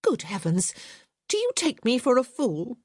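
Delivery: put-on affronted young lady scoffing; indignant. A narrator's reading. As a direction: scoff at the very idea. Hyacinth indignant.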